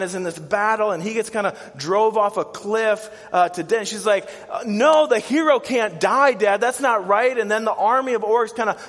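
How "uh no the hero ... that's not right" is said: high, loud acted child's protest; hammed-up distressed outburst; freaking out. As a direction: protest to Dad in mock panic. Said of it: "freaking out".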